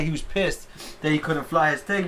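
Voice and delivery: ordinary delivery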